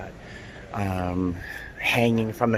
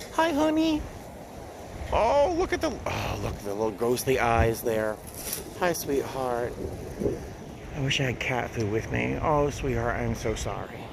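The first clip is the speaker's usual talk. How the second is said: cooing to a cat; 6 dB quieter than usual. Real, not acted. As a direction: melt over a little stray. Cooing at second stray cat.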